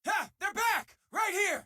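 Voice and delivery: high, excited shout pointing them out; triumphant callout. Acted. Crew member spotting the returning enemies, excited shout. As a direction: call the enemy's spot with glee.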